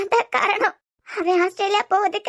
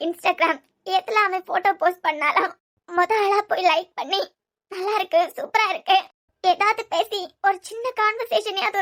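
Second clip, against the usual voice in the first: tearful sobbing; 2 semitones higher, 6 dB quieter than usual. Acted.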